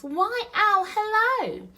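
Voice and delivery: very high, loud piping greeting; breezy mouse. Acted. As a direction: greet an acquaintance in a tiny mouse voice.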